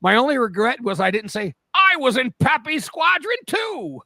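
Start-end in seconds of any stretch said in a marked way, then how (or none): 1.8-4.0 high, drawn-out mock boast. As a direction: crow it loudly, stretching it out. Acts comeback he wishes said.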